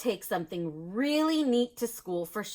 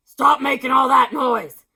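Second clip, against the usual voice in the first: loud stern principal; 3 semitones higher, 11 dB louder than usual. A narrator's reading. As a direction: bark at them to stop the noise. Principal shouting.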